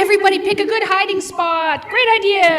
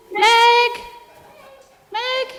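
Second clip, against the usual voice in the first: calling out; 8 semitones higher than usual. Acted. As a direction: shout her name to find her. Calling out for Meg.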